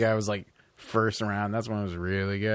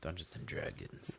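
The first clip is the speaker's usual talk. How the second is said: breathy whisper, much lower; 5 semitones lower, 16 dB quieter than usual. Acted. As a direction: whisper it like a secret. Answers mysteriously after a pause.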